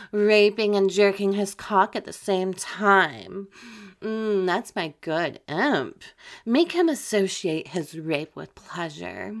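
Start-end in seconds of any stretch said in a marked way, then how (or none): none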